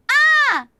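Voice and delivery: very loud, high "Ah!"; full-force short test scream; demo scream. Shown as a demonstration. Streamer testing for peaking, loud high scream. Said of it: "what if I go".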